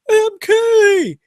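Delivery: very high, loud imitated shout; mock calling out. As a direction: belt the name out, stretched and shrill. Tilda is like.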